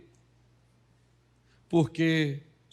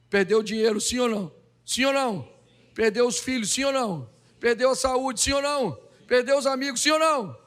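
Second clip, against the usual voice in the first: building questions; 6 semitones higher than usual. Acted. Preacher listing Job's losses.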